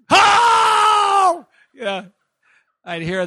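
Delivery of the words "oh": high, loud startled shout; sudden ecstatic cry. Retold or imitated. Mimicking a worshipper, sudden loud cry. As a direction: erupt out of nowhere.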